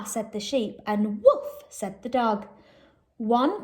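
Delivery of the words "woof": high, loud woof; dog woof. Acted. Teacher reading a farm story, a high dog woof. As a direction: bark it out like a little dog.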